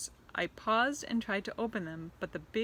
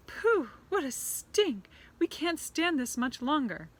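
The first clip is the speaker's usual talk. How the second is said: high, slow, disgusted witch voice; witches crying out; 8 semitones higher, 6 dB louder than usual. Acted. Witches smell the boy, disgusted high cries. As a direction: wrinkle your nose at the stink. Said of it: "there were cries of".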